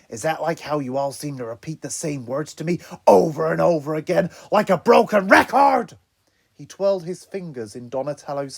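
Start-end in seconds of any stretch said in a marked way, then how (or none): 0.1-6.0 building to a loud shout; rising into an angry yell. Acted. Raph angrily lashing out.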